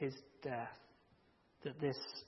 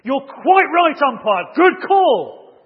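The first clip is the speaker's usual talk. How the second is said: higher, much louder mock-furious yelling; furious shout; 10 semitones higher, 18 dB louder, slower than usual. Player shouts even louder at the umpire, furious yell. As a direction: roar the praise like it's an insult. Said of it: "shouting even louder at the umpire".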